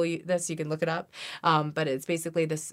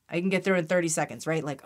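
ordinary talk, nothing marked

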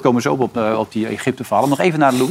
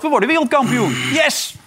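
much higher, imitating a bold coach; mock triumphant cheer; 13 semitones higher than usual. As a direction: pump it up like a cocky victory cry. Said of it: "zijn ambitie is groot". (trainer spreekt zijn ambitie uit, gespeeld juichend)

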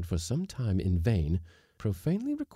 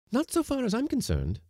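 haughty lady. Acted. Mrs Blossom answers coolly.

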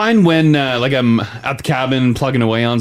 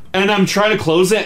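ordinary talk

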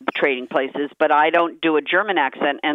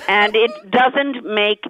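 ordinary delivery

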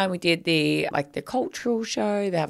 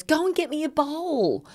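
put-on much higher little kid voice; bossy child; 10 semitones higher than usual. Imitating Elsie ordering mum to fetch a bowl. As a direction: demand it like a small child. Quoting young Elsie demanding.

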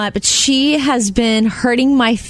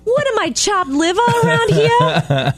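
high, mock-offended complaint; 9 semitones higher than usual. Host pretending to be hurt by her dog, higher and mock indignant. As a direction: play wounded pride for laughs. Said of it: "And I'm just like".

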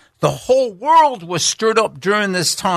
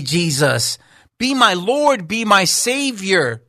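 higher, fervent prayer voice; heartfelt prayer; 6 semitones higher than usual. Acted. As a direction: pray it aloud for them. Leads the listener in prayer.

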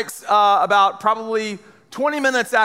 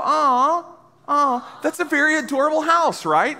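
high cooing over a cute house; mock cooing; 7 semitones higher than usual. Speaker invites the audience to coo at the house photo. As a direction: coo like you just saw something adorable. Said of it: "you can go".

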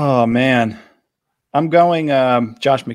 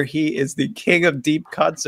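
ordinary talk, nothing marked

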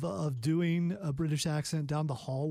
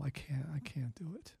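whispered refusal, much softer; embarrassed whisper; 2 semitones lower, 10 dB quieter than usual. Real, not acted. Refusing to do accent on air.

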